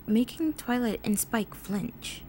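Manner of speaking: ordinary delivery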